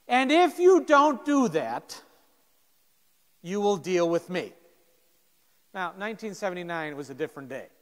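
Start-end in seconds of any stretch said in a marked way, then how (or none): 0.1-4.5 louder and slow, then firm; threat. Retold or imitated. Captain warns the jerk sophomore, loud slow threat. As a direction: make every word a warning.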